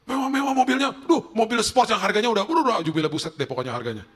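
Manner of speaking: high, loud awe; acted amazement. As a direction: marvel out loud at the luxury. Inner amazement at friends' luxury cars. (kagum heboh bernada tinggi)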